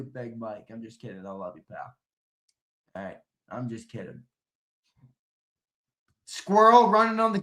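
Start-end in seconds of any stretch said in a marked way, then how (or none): 0.0-4.2 much softer and lower, almost murmured; soft backpedal. Real, not acted. Streamer softly taking back his jab at a viewer, murmured backpedal. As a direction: take it back gently.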